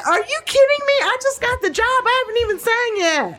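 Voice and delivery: high, indignant disbelief; young girl's high protest; incredulous protest. Retold or imitated. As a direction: squeak it out, horrified. Young singer protesting to dad.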